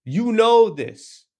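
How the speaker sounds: Krishna pressing Duryodhana. Retold or imitated. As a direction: press the point home slowly. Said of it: "He says".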